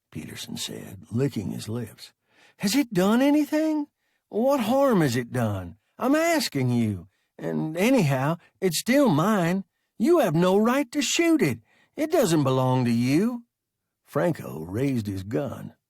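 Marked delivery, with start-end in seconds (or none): 2.6-13.4 rising, louder plea in defence of his pet; indignant protest, higher and louder; heated protest. A narrator's reading. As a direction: push back hard, voice climbing. Peterson protests shooting his wub.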